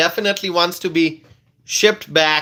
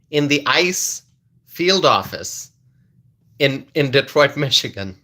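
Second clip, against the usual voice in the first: ordinary delivery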